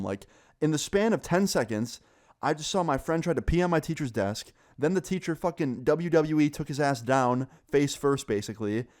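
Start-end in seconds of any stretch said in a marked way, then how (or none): none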